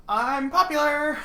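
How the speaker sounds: high, drawn-out mock voice; Shay. Teasing the runner Shay's fame, high drawn-out impression. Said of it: "Shay's just like".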